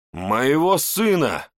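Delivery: slow roaring cry of grief. A narrator's reading. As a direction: roar the words out. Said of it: "взревел я".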